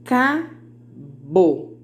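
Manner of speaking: cabo spoken slowly sound by sound for counting. Shown as a demonstration. Teacher counting sounds for students, cabo sounded out. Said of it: "Vamos contar?".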